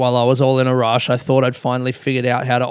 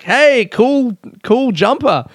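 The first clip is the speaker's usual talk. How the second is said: mock friendly; 8 semitones higher, slower than usual. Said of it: "he's just like".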